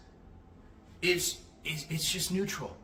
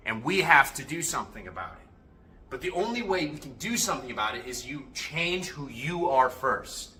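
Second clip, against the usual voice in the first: normal talking voice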